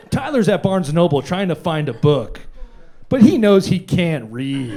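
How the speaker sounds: hammed-up campaign smear read; political attack ad voice. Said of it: "Political attack ad voice".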